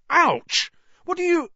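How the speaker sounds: high, loud startled yelp cut off mid-question; pained yelp. Acted. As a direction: yelp in sudden pain and break off. Ron hit over the hand.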